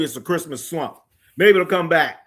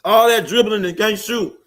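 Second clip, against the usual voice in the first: exasperated outburst; 8 semitones higher, 7 dB louder than usual. Real, not acted. Exasperated rant about NY guards.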